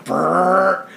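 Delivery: buzzing transformer noise; imitated buzzing. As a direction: mimic an electric crackle. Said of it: "all of a sudden I hear".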